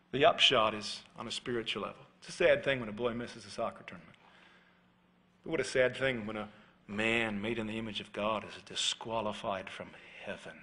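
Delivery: normal talking voice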